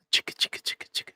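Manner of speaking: whispered chicka chicka shaker noise; shaker sound. Shown as a demonstration. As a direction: rasp it like shaking an egg shaker. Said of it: "these shaking eggs".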